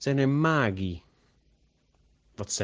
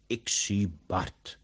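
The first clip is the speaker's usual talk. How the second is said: slow, mysterious whisper; whispered fortune-telling; 3 dB quieter, slower than usual. A narrator's reading. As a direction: whisper it like a magician seeing a vision. Giacomo acts as a fortune-teller.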